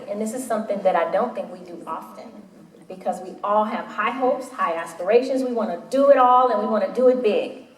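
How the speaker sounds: ordinary talk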